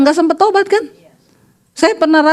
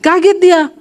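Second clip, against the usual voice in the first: ordinary delivery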